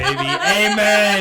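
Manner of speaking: loud amen through laughter; loud approving cheer. Real, not acted. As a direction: shout your hearty approval, laughing. Cheering a crude joke in agreement.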